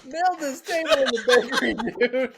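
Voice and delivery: high, loud, laughing through the words; real helpless laughter. Real, not acted. Friend tells of kid hitting table, laughing helplessly. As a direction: crack up while telling it. Teller cracks up at own story.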